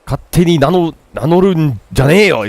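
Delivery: scolding brother. Acted.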